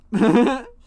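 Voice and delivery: short, high burst of laughter; loud laugh. Real, not acted. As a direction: crack up loudly at your own pun. Laughs at own pun.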